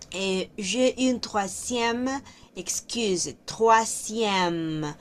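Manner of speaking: "troisième" slowly, twa-zyem; slow French demo. Shown as a demonstration. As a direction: spell out "Troisième" sound by sound. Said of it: "aquí hay que tener mucho cuidado". (pronunciación lenta de "troisième")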